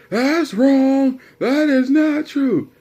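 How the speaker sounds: slow, loud, high mock outrage; outraged dad. Dad rejecting his son's definition, outraged. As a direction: yell it drawn out, contradicting flatly.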